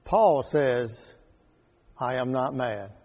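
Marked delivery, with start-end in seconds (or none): 2.0-2.9 low, soft, steady denial; calmly. Retold or imitated. Paul answering Festus's accusation, low and calm. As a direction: answer quietly and without heat. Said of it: "Paul calmly rejects".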